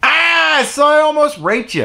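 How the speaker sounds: tossed-off mock shrug of a line; mocking Spacey. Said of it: "You don't get".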